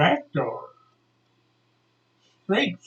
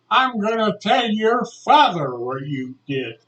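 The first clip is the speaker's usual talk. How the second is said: raised, louder retold warning; lightly acted scolding coach; coach's threat; 7 semitones higher, 5 dB louder than usual. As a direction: threaten to report a pupil.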